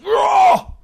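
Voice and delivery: sudden shouted high word; raw outburst. Shown as a demonstration. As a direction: blurt it out raw and loud. Contrast with acting slowly.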